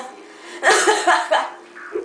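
high, loud burst of laughter. Real, not acted. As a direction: crack up loudly. Laughs at his game.

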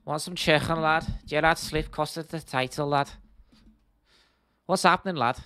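playful put-on Scouse accent. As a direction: ham up a Liverpool lad accent. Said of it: "Can we get a Scouse accent?".

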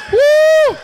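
high whooping cry; preacher's whoop. Real, not acted. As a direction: let out a joyful whoop.

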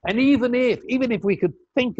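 "even" thrown out high and loud; emphatic preaching. Real, not acted. Preacher rising on the congregation, loud and emphatic. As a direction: burst out on "even if".

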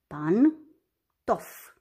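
"Pantof" broken in two, pan - tof, pause between; despărțit în silabe. Shown as a demonstration. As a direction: say "Pantof" syllable by syllable. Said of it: "Despărțim în". („Pantof” pe silabe, cu pauză: pan - tof)

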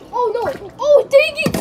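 ordinary talk

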